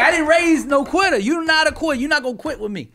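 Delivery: high, emphatic mama tirade; mother's scolding. Retold or imitated. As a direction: imitate mama refusing to let her son quit. Imitating mama scolding her son.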